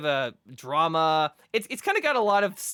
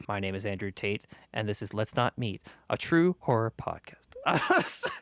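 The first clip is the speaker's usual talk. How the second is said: lightly deepened narrator voice; a hint of the hypnotic storyteller; Andrew Tate impression; 8 semitones lower than usual. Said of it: "I do that impression".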